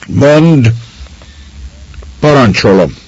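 high, loud, cracking order; a woman ordering the lad to speak; snapping command. A narrator's reading. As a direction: snap it out like a whip. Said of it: "Csattantak a szavai".

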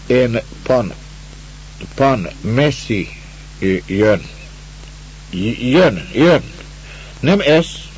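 lightly voiced weary Russian stranger; halting, tired, a hint of despair; broken-Hungarian Russian. The stranger tells the master why he lay on the road, halting.

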